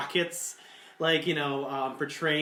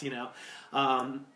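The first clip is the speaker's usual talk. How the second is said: ordinary talk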